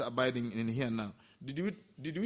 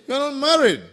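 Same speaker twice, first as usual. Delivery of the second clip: much louder, higher blunt reply; loud retort; 11 semitones higher, 13 dB louder, faster than usual. Retold or imitated. Villager telling the monk loudly. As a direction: blurt it out, loud and sure.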